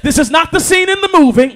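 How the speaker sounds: shouted pulpit repeat; a repeat pushed up a notch. Acted. Repeats the line, stepping up.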